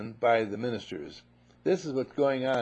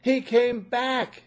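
high, loud, slow cry of joy; overjoyed father; 11 semitones higher, 6 dB louder, slower than usual. Acted. Father seeing his lost son come home, overjoyed. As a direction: marvel that he's home.

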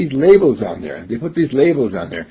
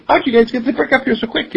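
high, fast amazed question; surprised masons; 11 semitones higher, faster than usual. Acted. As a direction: imitate astonished workmen, pitched up and quick. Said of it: "were all so surprised".

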